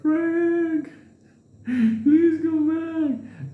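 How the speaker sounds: very high, slow crying plea; crying plea to Frank; whimpering plea. Retold or imitated. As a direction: beg Frank to return like a scared child. Pleading at dawn.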